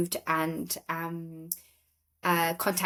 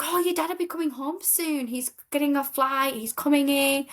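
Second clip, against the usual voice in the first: Polish lady reassuring; 7 semitones higher than usual. Retold or imitated. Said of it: "He's like".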